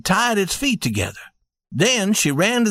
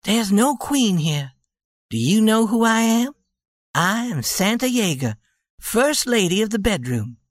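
playful; 5 semitones higher than usual. A narrator's reading. Wife playful reveal.

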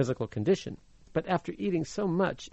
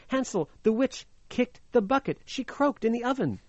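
put-on higher, relieved girl's news; Gretel, excited and high; 7 semitones higher than usual. A narrator's reading. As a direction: blurt it out, relieved. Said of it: "and said".

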